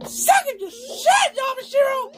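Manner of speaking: high-pitched angry screech; screaming and yelling. Acted. Aqua cursing Yamashiro mid-fight, high screaming. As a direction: shriek insults at a rival. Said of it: "quit screaming and yelling".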